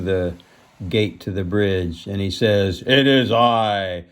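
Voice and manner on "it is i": slow, drawn-out big goat voice; weighty, slow proclamation; grand announcement. A narrator's reading. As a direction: announce yourself as the biggest goat. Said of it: "the big billy goat announced".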